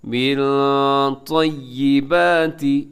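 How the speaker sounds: long nasal hum closing मिन before तय्यिबाति; ikhfa. Shown as a demonstration. Teacher demonstrating noon sakin before tau, ikhfa on मिन. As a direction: hold the n of "मिन" in the nose, then "तय्यिबाति" slowly. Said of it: "तौ हरूफ इख्फा में शामिल है".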